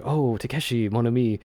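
lightly imitated French-speaking character; French phrase in a light impression; French accent. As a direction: slip into a little French accent. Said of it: "phrase she'll say in, in French".

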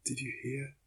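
murmured question with a rise on hear. A narrator's reading. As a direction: murmur it in the dark, barely voiced. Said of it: "I murmured".

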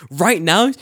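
high, loud "right now?" rising; startled question. Retold or imitated. Friend put on the spot, loud startled question. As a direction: caught off guard, blurting.

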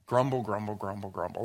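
muttered grumbling. Shown as a demonstration. Preacher demonstrating the sound of the word grumble. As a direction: mutter it under your breath like a grumble. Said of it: "A word that sounds like what it means".

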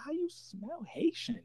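disbelief. Retold or imitated. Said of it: "And I was like".

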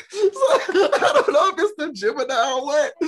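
said through a real laugh, high-pitched. Real, not acted. Podcast guest blaming it on the Gemini sign, laughing. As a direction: let laughter lift and break up the words. Laughing at own astrology excuse.

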